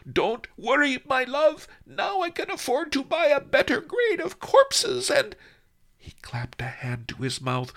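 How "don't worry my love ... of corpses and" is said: high, loud shout to the coffin; Doctor Death shouting to his dead wife. Acted. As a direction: shout it up across the room. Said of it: "turned to the coffin and shouted".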